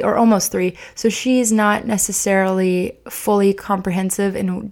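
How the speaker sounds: ordinary talk, nothing marked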